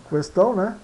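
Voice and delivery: normal talking voice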